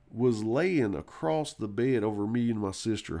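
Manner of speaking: normal talking voice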